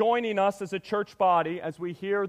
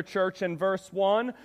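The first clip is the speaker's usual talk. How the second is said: normal talking voice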